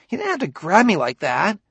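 high-pitched, wounded protest; grieved tones. A narrator's reading. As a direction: complain like a hurt boy. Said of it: "he said in grieved tones".

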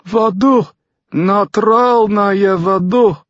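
high, loud, drawn-out croak; croaking drunk Russian. A narrator's reading. Bleary Fyodor demands water from Tucker, croaking. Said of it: "and croaked".

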